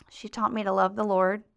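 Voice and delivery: plain reading voice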